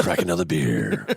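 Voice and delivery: deep, hammed-up calm radio voice; mock soothing host. Joins mock relaxation voice.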